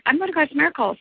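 high, sing-song mock friend; chirpy friend. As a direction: play the eager friend inviting you along. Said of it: "my friend was like".

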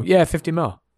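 high, loud, breezy yeah, fifty mil; a rich owner shrugging, mock-casual; mock careless owner. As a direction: toss off a huge sum like pocket change. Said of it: "Teams that just see a dude and go".

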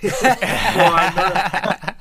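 brushing it off through a real laugh. Real, not acted. Answering the host about coming back, laughing. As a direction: laugh the denial away.

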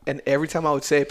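ordinary talk, nothing marked

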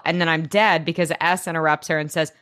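ordinary talk

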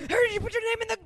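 high, loud wizard voice collapsing; failed Dumbledore impression. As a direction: try the frantic wizard line and fail. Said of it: "I can't even do it".

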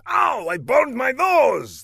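very high, slow yelp of pain; high yelp over a burned nose; pained exclamation. A narrator's reading. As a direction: squeal it like a hurt villain. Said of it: "the figure exclaimed".

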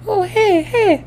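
caught-out falsetto greeting, imitating an uncle; loud, squeaky, hurried cover-up hello; switch to high voice. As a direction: jump into a very high put-on voice. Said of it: "like, a deep voice".

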